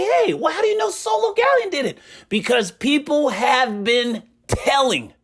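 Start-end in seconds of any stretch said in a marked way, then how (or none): 0.0-2.0 high, fast mocking voice; squeaky skeptical voice, quick; mock viewer question. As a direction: play the doubting viewer, pitched up. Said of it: "So you guys say".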